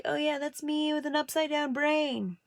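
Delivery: put-on high, casual kid voice; matter-of-fact child. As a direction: explain it like an unbothered little kid. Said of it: "I'm like".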